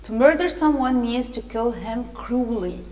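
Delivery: ordinary delivery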